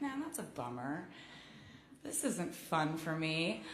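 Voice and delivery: low, soft letdown; mildly deflated, voice dropped down; mock bummed out. Singer recalling her first reaction to the articles, mildly bummed. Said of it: "my first reaction was to be like".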